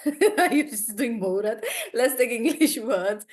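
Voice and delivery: ordinary delivery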